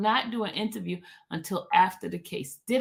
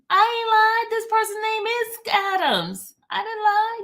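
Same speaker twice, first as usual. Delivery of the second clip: high-pitched protest of innocence; high, defensive denial; indignant protest; 11 semitones higher than usual. Real, not acted. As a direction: insist you didn't lie, pitched up. Defends herself against chat.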